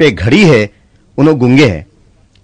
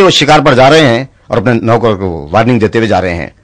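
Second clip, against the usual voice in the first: ordinary talk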